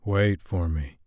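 lightly growled command; low growl. A narrator's reading. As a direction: growl the words Wait for me. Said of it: "he growled".

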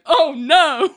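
high, dismayed mock of Larry; Larry's oh no. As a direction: pull away with a high oh no. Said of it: "Just the way he said it".

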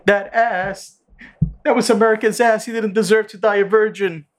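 hammed-up drunk sobbing; drunk crying She-Hulk. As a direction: whine it like a tipsy crier. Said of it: "drunk crying".

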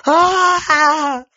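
high, loud, drawn-out cry; sudden scream. Acted. Old woman suddenly seized by a vision, loud high scream.

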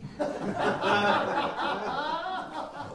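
a high burst of real laughter. Real, not acted. Laughs at his own joke.

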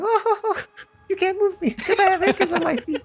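high-pitched woman voice. Acted. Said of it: "She's like".

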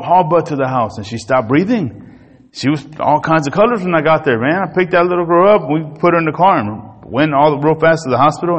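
ordinary talk, nothing marked

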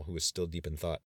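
ordinary delivery, nothing marked

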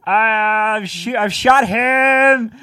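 high, loud and slow cartoon boast; slow, high heroic cartoon brag. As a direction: crow like a proud cartoon soldier. Cartoon skit, proud hero.

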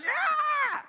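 high, loud mock squeal of delight; children's excited cheer. Grandchildren cheer at promised ice cream.

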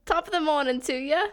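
chirpy bad British greeting; Sonic, British accent. Greeting the mates as Sonic, in a hammed-up British accent. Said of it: "has a British accent".

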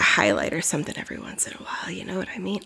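fast hushed whisper. Real, not acted. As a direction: drop to a quick whisper. Sudden aside whisper.

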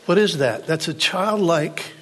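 high, loud, impassioned sermon delivery; full-force preaching. Acted. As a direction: drive it home to the congregation.

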